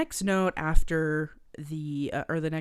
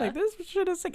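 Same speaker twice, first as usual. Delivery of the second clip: mock hype; 13 semitones higher than usual. Voicing delighted Sebastian.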